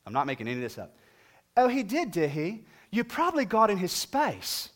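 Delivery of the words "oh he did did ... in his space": high, breezy put-on voice of a dog owner; dismissive lady. Dog owner brushing off the bitten man, high mock lady voice. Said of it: "the lady responds with this".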